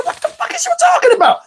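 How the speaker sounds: squeaky-high comic disbelief; acted-out shock in a very high voice; incredulous outburst. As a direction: shoot the pitch right up in bafflement. Acts shock at demon talk.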